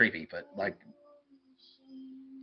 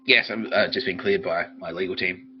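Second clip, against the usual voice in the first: ordinary talk, nothing marked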